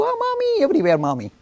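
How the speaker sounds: put-on high, sing-song little child; cooing child voice. Children seeing Mommy everywhere, cooing high. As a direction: coo it dreamily like a small child.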